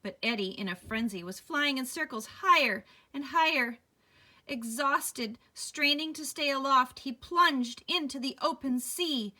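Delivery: loud, rising dramatic read; tense story reading. Acted. Reading the fall to a child, tense and loud. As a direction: build the danger.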